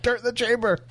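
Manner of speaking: mock yell, pitched high; imitated yelling. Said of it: "standing over your shoulder yelling".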